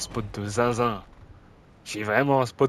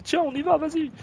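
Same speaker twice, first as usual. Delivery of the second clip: squeaky high mock voice of the other players; mock teammates. Player mocking his teammates who all rushed, squeaky high voice. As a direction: mimic the teammates as silly, much higher. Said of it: "tout le monde s'est dit".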